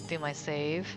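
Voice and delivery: ordinary delivery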